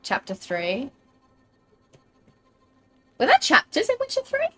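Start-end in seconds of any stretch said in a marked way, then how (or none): 3.2-4.5 surprised question. Real, not acted. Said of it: "I know there were chapters".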